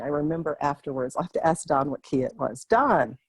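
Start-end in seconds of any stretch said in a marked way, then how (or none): 2.7-3.2 loud call across the house. Real, not acted. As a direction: call out to someone far off. Calling husband in another room.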